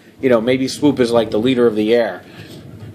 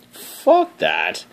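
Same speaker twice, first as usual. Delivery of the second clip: mock gruff dismissal; 7 semitones lower than usual. Mock disgust after high rant.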